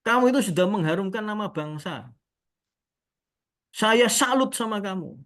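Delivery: high-pitched mock salute; changed, admiring tone. Haji saluting the tailor he mistakes, imitated lofty praise. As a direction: imitate a client gushing with respect. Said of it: "Dia kok ngomongnya seperti ini".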